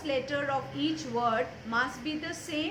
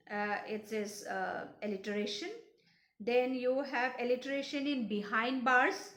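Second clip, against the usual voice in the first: ordinary talk, nothing marked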